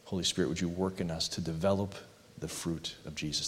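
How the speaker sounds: normal talking voice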